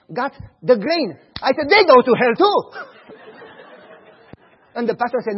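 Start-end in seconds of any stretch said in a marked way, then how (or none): 1.7-2.6 loud cheeky laugh through the words; child retorting to the pastor, laughing loudly. Retold or imitated. 2.6-4.1 laugh. Real, not acted. Laugh after retort.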